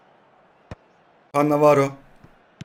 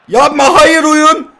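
loud, high, slow wail of protest; anguished yelling; 9 semitones higher, 8 dB louder, much slower than usual. Real, not acted. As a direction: howl in disbelief at the goal. Concedes a goal, protests. (çaresiz uzun haykırış)

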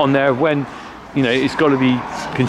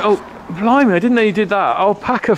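surprised; 8 semitones higher than usual. Real, not acted. Surprised at finding alpaca food.

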